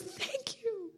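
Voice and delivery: high, very soft, choked up; hushed, emotional high voice; tearful retold thanks. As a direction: squeeze out a small grateful cry. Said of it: "it just makes me cry".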